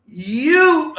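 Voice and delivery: very high, loud, held YOU; rally call. Shown as a demonstration. Speaker starts the audience chant, loud rally call. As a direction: belt out one long word.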